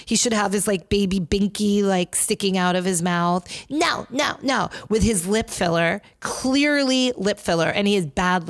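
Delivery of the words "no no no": put-on high, bratty toddler no; foot-stomping no. Host mimicking Santos as a tantrum-throwing kid, bratty high no. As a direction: stomp your feet like a sulking child. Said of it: "stomp his feet".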